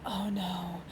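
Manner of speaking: breathy mock-dread whisper of oh no; retold whisper. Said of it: "And I'm like".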